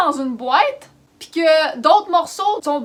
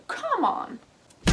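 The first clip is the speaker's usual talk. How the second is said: put-on English accent; 5 semitones higher, 4 dB quieter than usual. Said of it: "Avec l'accent anglais".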